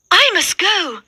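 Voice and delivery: high, hurried alarm; Cinderella's voice. A narrator's reading. Cinderella at midnight rushing from the ballroom, high and urgent. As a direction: gasp it out in a hurry. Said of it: "she says".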